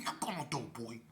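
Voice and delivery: unvoiced whisper, pressing him to knock; whispered order. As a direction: hiss it so the house can't hear. Whispering at the door.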